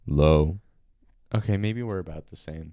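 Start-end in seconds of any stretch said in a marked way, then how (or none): 0.1-0.6 loud, low test note; low-voiced echo for a sound check. Shown as a demonstration. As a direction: drop into a low tone, loudly. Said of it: "like, a lower tone".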